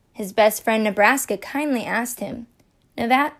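plain reading voice

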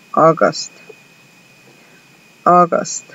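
August said like AW-gust; model pronunciation. Shown as a demonstration. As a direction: clear English model. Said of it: "pronunciación de agosto".

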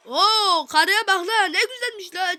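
loud, high catcalling voice; hammed-up catcall. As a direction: whistle at her in a silly voice. Oyundaki kadına laf atıyor. (kadına laf atan abartılı, yüksek ses)

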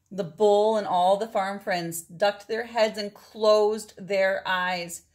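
plain reading voice

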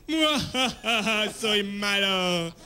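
slow hammed-up movie villain cackle; slow mock evil laugh and boast. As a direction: play the cheesy film baddie, drawn out. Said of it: "el malo es el que le hace".

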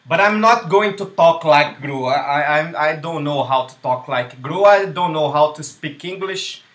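put-on loud, slow English with a Brazilian accent. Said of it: "faz o sotaque inglês".

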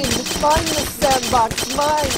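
put-on possessive child voice. Possessive childish claiming of sandbox.

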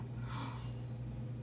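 a sharp breathless gasp of surprise; sudden real gasp. Real, not acted. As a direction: gasp in at what you just spotted. Spots an animal in room.

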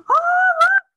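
very high, loud excited cry. A narrator's reading. Mole spotting Goose's fish, very high excited cry. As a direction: burst out thrilled at the catch. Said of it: "cried Mole".

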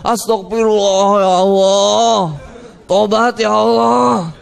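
slow, drawn-out mock wail; imitated crying out. Drunk crash victim cries out to God, mock wailing. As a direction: stretch each plea like the injured drunk. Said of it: "teriak-teriak dia".

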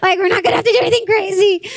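high, laugh-filled retold rejoicing; laughing relief. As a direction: exclaim with relief, breaking into laughter. Acting out comic relief, laughing.

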